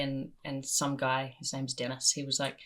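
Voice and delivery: ordinary delivery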